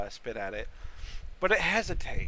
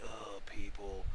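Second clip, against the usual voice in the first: grumpy sigh; 9 semitones lower, 8 dB quieter, slower than usual. Acted. Mimics grumpy mushroom voices.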